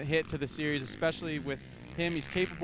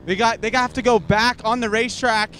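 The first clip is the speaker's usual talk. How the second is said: loud, urgent and high; shouting the big news over the noise; excited pit report; 5 semitones higher, 12 dB louder than usual. Real, not acted. Pit reporter with major shakeup.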